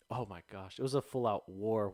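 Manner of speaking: usual speaking voice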